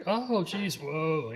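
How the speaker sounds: high, sudden yelp of surprise; mock startled driver. A driver startled by a sudden honk, yelping in mock surprise.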